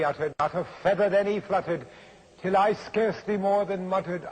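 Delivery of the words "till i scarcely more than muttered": usual speaking voice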